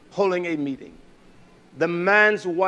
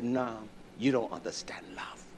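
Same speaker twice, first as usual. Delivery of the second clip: soft, low whispered put-down; a whisper of contempt; whispered rebuke; 6 semitones lower, 12 dB quieter than usual. Retold or imitated. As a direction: breathe it quietly at him. Retold rebuke to the husband.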